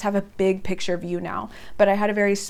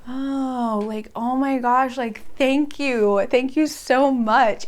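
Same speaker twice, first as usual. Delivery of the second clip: overwhelmed gratitude; 4 semitones higher, much slower than usual. Retold or imitated. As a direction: draw out each thank you, swelling with relief. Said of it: "And I was like".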